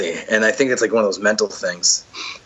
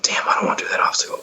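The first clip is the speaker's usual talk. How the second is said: breathy whisper of inner reluctance; a hushed grumble to yourself; whispered dread; faster than usual. Retold or imitated. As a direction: whisper it like your own doubting thoughts.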